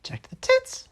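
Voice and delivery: very high, loud squeal; loud sharp voice. Shown as a demonstration. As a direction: shriek it way up high. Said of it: "that's how loud you should have said it".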